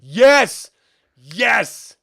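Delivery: loud, high, eager yes; excited shout. Real, not acted. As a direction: shout it out in recognition. Recognizing the awful audiobook.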